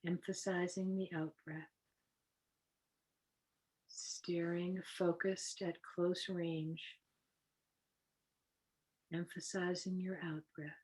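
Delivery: softly spoken breathing-exercise guide; guided meditation. Acted. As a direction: lead them gently into the breathing, unhurried.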